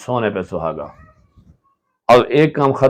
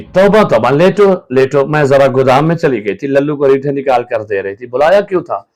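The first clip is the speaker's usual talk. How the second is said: higher and louder fussing; fussing loudly; 3 semitones higher, 6 dB louder than usual. Acted. Wife fussing over her sick husband, loud. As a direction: fuss over him in a hurry.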